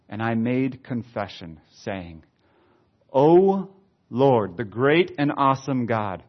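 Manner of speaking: slow, emphatic scripture reading with swells; preacher voicing a confession prayer; prayer read aloud. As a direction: lift the address to God, then measure each phrase.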